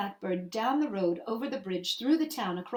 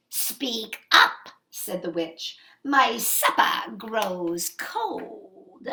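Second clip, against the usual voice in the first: loud and very slow menace; impatient witch; 4 dB louder, much slower than usual. Acted. Witch warning the mother her supper grows cold. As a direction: lean on each word.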